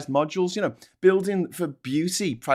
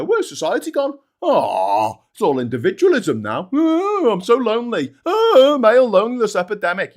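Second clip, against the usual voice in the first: higher whiny sighs on each Oh; mock lonely whine; 5 semitones higher, 6 dB louder than usual. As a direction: lampoon their self-pity. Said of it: "you look around and you go".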